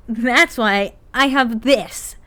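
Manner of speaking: slow, loud, gloating reveal; smug evil grin. Acted. Han gloating as she reveals the duffel bag. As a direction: savour the trap you've sprung. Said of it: "Han grinned evilly".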